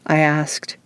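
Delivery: ordinary delivery, nothing marked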